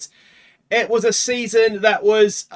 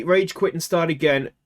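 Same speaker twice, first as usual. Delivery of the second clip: ordinary delivery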